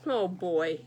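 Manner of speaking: usual speaking voice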